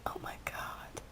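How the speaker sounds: barely voiced, breath-only whisper; awed whisper. Fan swooning over a cute new kid, awed whisper. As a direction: whisper it to yourself, overcome.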